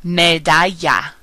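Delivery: slow Spanish "Medalla" with LL as Y; double L as Y. Shown as a demonstration. Teacher modelling double L words, Y sound in "Medalla". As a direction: say "Medalla" as "meh-DAH-ya". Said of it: "sounds like the Y in the word yes".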